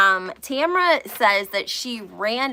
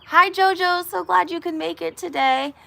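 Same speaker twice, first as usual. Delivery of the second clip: a hint of a catty hostess; fake-sweet greeting; 8 semitones higher than usual. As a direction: greet her sweetly while meaning the opposite. Mock Teresa greeting with claws out.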